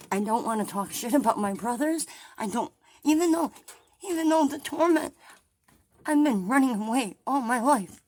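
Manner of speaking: loud, high, indignant shouting; furious rant. Real, not acted. Vlogger raging at her brother over the house sale, loud angry rant. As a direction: hammer home one little house in outrage. Ranting about brothers' torment.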